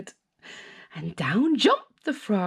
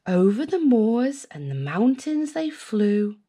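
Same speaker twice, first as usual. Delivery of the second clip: ordinary delivery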